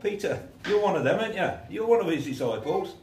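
high, loud pointed accusation; a servant girl pressing Peter hard; accusing bystander. Acted. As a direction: corner Peter with it.

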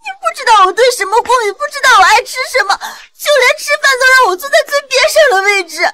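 high-pitched, anguished accusation; hurt outburst. Acted. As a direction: cry out years of grievance. Daughter confronts mother in hurt outburst.

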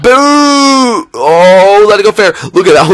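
high, loud drawn-out hoot; excited cheer. Real, not acted. Gamer watching his hit fly, excited cheer. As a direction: whoop as the ball flies.

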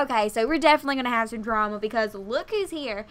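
ordinary talk, nothing marked

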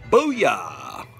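loud celebratory booyah; excited exclaim. Real, not acted. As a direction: shout it out with glee. Exclaims before package reveal.

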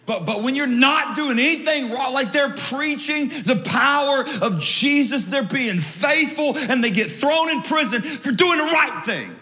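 high, driving sermon cry; high-pitched, emphatic pulpit delivery; impassioned preaching. Real, not acted. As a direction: preach it hard, stretching the key words. Preaches at full force.

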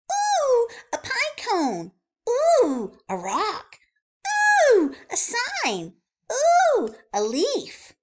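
high squealing wonder at each thing; dazzled ooh. Acted. Wordy Birdie ignoring the warning, squealing with wonder. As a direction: gasp with delight at everything.

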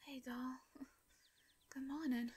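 ordinary delivery, nothing marked

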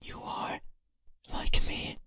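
eerie unvoiced whisper; whispering entity. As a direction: breathe it like a ghost.

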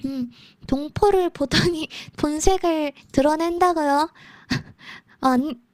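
usual speaking voice

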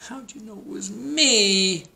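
high, slow turkey wail; hammed-up wail. A narrator's reading. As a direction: wail it out like a cartoon turkey found out. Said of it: "wailed Turkey".

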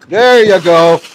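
calling out. Real, not acted. Feeder calling to animals while giving feed, loud. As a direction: shout it over the noise of the feed.

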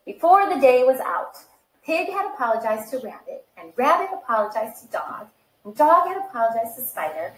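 lively read-aloud storytelling voice; animated picture-book reading; story narration. Acted. Reads picture book to children.

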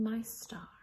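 ordinary delivery, nothing marked

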